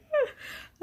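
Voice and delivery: a high burst of giddy laughter; squealing fan laugh; delighted laugh. Real, not acted. Gushing about tourist scene.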